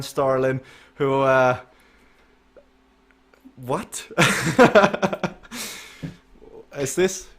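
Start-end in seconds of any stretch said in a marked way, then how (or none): 4.1-5.3 high burst of laughter; surprised laugh. Real, not acted. Host reacts to Aaron's antics, amused laugh.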